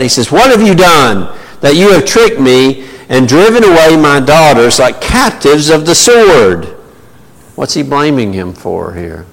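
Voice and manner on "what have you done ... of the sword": upset Laban. Acted. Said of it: "He's hot and he's he-, you know, he's upset".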